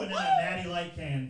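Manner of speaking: ordinary talk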